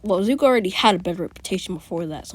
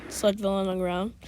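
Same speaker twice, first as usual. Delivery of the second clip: ordinary talk, nothing marked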